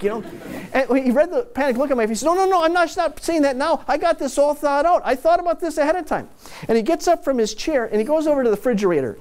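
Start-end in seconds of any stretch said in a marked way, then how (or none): 2.2-6.3 put-on higher, eager old-man voice hurrying to reassure; Ralph reassuring. Old Ralph to the worried narrator, a higher, eager reassurance. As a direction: jump in fast to calm the friend's panic, pitched up.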